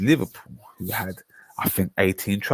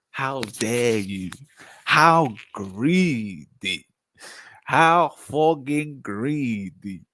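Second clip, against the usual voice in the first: slow, drawn-out hammed-up indignation; mock outrage; 2 semitones higher, much slower than usual. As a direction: stretch each word in pretend shock.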